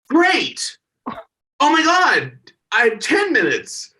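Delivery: high, thrilled, drawn-out delight; excited. Retold or imitated. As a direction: gush like you just got a gift. Quoting own excitement.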